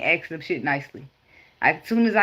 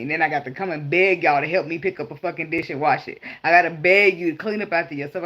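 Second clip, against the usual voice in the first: usual speaking voice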